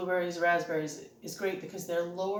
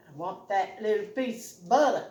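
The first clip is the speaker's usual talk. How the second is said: put-on playfully weird, punching BUTTER louder in her accent; silly, louder, accented outburst; getting weird; 2 semitones higher, 6 dB louder than usual. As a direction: goof around with it and lean on "buttah". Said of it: "now I'm getting weird".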